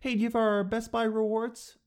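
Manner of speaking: upbeat rewards offer; chirpy clerk pitch. Retold or imitated. Best Buy clerk offering rewards, chirpy and high. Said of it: "This is the way to not do it".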